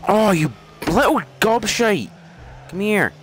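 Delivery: high, angry outburst at the game; yelling at an opponent who scored. Real, not acted.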